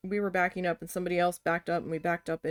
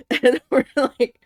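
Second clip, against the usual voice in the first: laughing; 5 semitones higher, 13 dB louder, slower than usual. Real, not acted. Laughing while telling.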